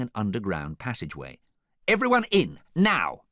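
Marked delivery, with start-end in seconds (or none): 1.9-3.2 high, loud command; forceful raised-voice instruction; urgent order. A narrator's reading. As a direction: order everyone inside at once. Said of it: "she ordered".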